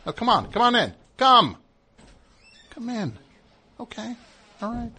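welcoming writer. Acted. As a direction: usher people in cheerfully.

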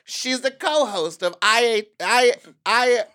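loud, high, drawn-out announcing; announcer introduction. Pretend. Host bringing out the guest, big announcer voice. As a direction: proclaim the guest's credit slowly.

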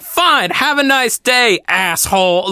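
slow, high-pitched mock huff; hammed-up spiteful sign-off; imitated parting insult. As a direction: toss it off like an offended woman storming away. Angry woman's parting insult, retold.

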